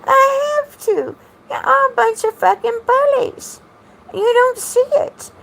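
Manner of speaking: high-pitched, laughing while talking; helpless high laugh in the words. Real, not acted. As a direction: talk through your own laughter, pitch way up. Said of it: "I'm always laughing at myself".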